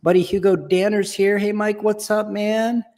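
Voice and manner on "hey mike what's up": ordinary talk, nothing marked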